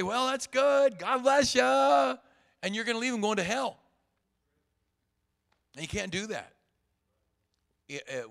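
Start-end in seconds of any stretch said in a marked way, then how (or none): 0.0-2.2 higher, louder and slow, cheerily waving it off; a hammed-up breezy send-off; breezy brush-off. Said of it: "you'll just say".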